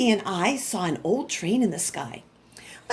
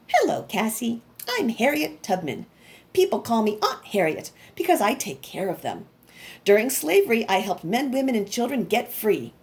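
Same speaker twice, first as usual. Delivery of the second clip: usual speaking voice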